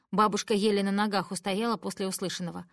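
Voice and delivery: ordinary delivery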